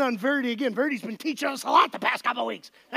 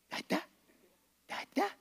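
put-on soft coaxing baby talk; 3 semitones higher, 10 dB quieter than usual. Dad coaxing baby to say dada.

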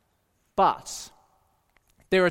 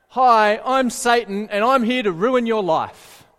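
loud, brazen announcement as a joke Satan; mock Satan introduction; 6 dB louder than usual. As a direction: announce yourself loudly as a hammed-up devil.

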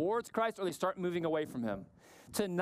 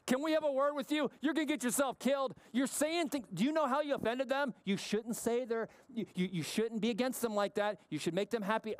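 higher-pitched, hurried, stammering scolding imitation; imitated worried family; 4 semitones higher than usual. Family warning Jesus he offended them, high fretful imitation. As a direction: fuss at him in a rush, starting high. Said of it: "the family's outside going".

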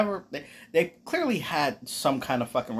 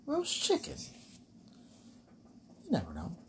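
high silly voice; 4 semitones higher, 9 dB quieter than usual. Retold or imitated. Voicing a hobbit's line.

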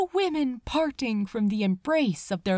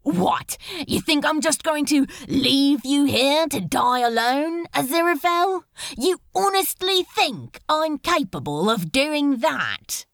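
loud, raised disbelief; indignant outburst; 3 semitones higher, 4 dB louder than usual. Acted. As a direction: throw the question back, offended. Crowley refuses to leave him.

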